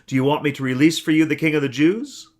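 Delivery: a ruler offering a choice to the mob; Pilate to the crowd. A narrator's reading. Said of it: "he answered them".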